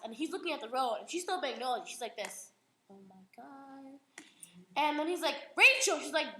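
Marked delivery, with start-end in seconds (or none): 2.9-4.0 very soft, slow murmur; hushed awe. Retold or imitated. Girl staring at the wreck beside the road, hushed awe. 5.5-6.0 loud, high, sudden call; warning shout. Retold or imitated. Dad warning his daughter at the wheel, urgent shout.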